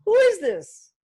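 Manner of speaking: high, baffled squeal of a caught-off-guard woman; confused stranger. Retold or imitated. A stranger caught off guard by a cold call, squealing in confusion. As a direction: play the startled stranger: what on earth?. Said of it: "looking like that girl and be going".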